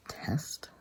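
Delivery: high, breathy whispered echo of the word; baffled. Retold or imitated. Caught off guard by the nurse's word, whispered disbelief.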